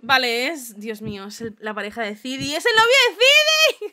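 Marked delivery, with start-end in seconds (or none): 2.5-3.9 high, loud shout of surprise; excited shout. Real, not acted. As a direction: blurt it out, thrilled. Spotting the boyfriend on screen. (grito emocionado de sorpresa)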